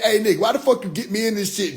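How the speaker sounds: lightly acted high-pitched grievance; mock indignant complaint. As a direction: complain like you got dragged into it. Acted quote of an annoyed voice.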